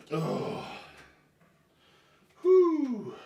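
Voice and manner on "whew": relieved whew. Real, not acted. Catching breath.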